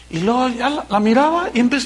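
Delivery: ordinary talk, nothing marked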